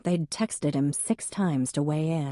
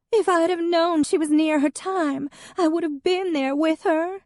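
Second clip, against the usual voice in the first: high, louder, tearful wail; weeping Hadley; 13 semitones higher, 4 dB louder than usual. Acted. Hadley to Jack about her dead cat, weeping high. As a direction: wail it like the world has ended. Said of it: "Hadley wept".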